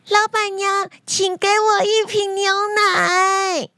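put-on high, drawn-out child's shout; sudden loud call. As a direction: cry out at the top of a little girl's voice. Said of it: "小慧突然大声地喊".

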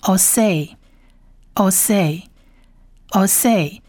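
"osei" slowly, as "oh-seh"; blended word demo. Shown as a demonstration. Teacher joins three letters for learners, "osei" slow and clear. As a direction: repeat "osei" slowly, pausing after each. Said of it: "合起来就念成osei".

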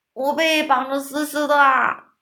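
high, loud roaring giant. A narrator's reading. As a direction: roar it out as a trapped giant. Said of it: "吼叫着说".

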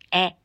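A said as the short vowel in "scan"; 示范短母音. Shown as a demonstration. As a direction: give a short, open A as in scan. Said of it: "要念A". (把A念成scan里的短母音)

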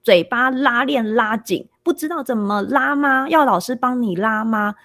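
put-on a child parroting his teacher's taunt, slow and sing-song high; 小孩模仿老师，语调偏高、放慢; mimicking a stern teacher. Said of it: "他就模仿老师".

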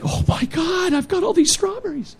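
very high, whiny mock little brother. Little brother panics in the car, squeaky mock whine.

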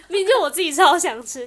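laughing loudly at herself; bursting into laughter mid-confession. Real, not acted. Laughing while admitting she was the one who wanted it.

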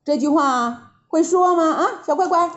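put-on high, singsong teasing of a child; condescending baby talk; patronizing. A narrator's reading. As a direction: check on the little one, mockingly. Monkey King patronizes little Nezha.

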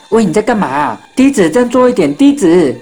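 acted anxious shout; urgent calling. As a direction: try to call a friend back to their senses. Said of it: "大家一直叫他".

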